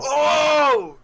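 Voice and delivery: loud, high cheering "Oh!"; excited shout. Real, not acted. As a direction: yell it out in delight. Hears surprise subscription news, cheers.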